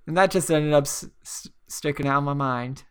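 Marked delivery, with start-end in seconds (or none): none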